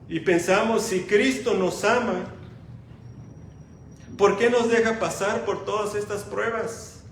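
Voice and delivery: loud, fervent pulpit preaching; preaching at full force. Acted. Preacher to the congregation about Christ's love, loud fervent preaching. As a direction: proclaim it to the whole church. Preacher voices doubts loudly.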